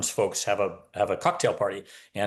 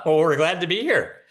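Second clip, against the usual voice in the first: chuckling through the words, a bit louder; laughing; 5 semitones higher, 3 dB louder than usual. Real, not acted. Guest laughing as he thanks the host for the welcome. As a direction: let a real laugh spill into the greeting.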